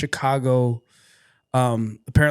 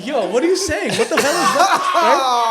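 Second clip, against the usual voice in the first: loud, high, laughing disbelief; bewildered protest; 11 semitones higher, 6 dB louder than usual. Retold or imitated. Nephew questioning his uncle in the car, bewildered. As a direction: protest loudly through a laugh, pitched up.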